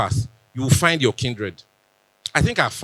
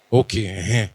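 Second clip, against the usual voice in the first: usual speaking voice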